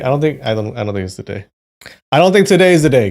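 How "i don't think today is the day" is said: frustrated outburst. Real, not acted. Frustrated about the quiz.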